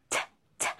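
whispered crisp T with teeth together; unvoiced T demo. Shown as a demonstration. Teacher shows T sound to students, whispered crisp T. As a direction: hiss out the T sound alone. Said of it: "Just remember the two teeth together".

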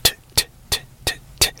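t as a bare voiceless tap of air; hard T sound. Shown as a demonstration. Teacher demonstrating the sound to avoid, isolated crisp "t". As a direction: repeat "t" as an isolated, crisp consonant. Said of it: "instead of it sounding like".

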